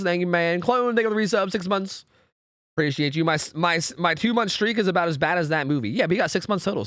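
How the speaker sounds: normal talking voice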